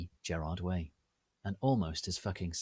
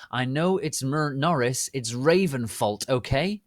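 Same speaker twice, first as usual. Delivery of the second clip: angry rant; 5 semitones higher, 5 dB louder than usual. Acted. Author's note ranting at haters.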